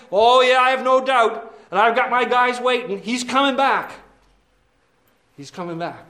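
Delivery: eager, high smooth-talking devil; smug devil. Pretend. Devil answering the brothers, eager and smug. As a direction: play the devil selling himself.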